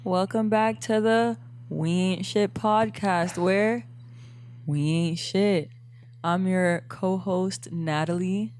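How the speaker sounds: loud, slow, drawn-out show welcome; podcast intro. Acted. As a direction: announce the show with big, spaced-out words. Opens the podcast as host.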